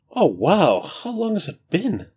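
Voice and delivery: high, breezy, carefree god; casual new voice. Acted. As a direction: stretch and chat like just woken. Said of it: "a tone, a cadence, that is totally unlike Jarl Troon".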